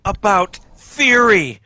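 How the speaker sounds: mock outrage. Mock panic about critical theory.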